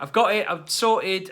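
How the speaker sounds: put-on high, loud, slow kid voice; mimicking son. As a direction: play the proud boy, slow and loud. Son's reply retold, mimicked.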